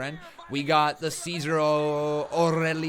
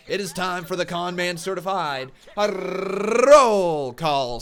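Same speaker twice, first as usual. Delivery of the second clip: drawn-out, louder, higher show-host announcement; announcer call; 4 semitones higher, 9 dB louder, slower than usual. Acted. Streamer opening his roll call segment, booming announcer style.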